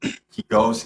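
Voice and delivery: plain reading voice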